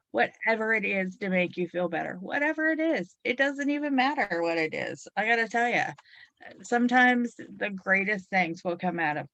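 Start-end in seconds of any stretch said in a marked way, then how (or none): none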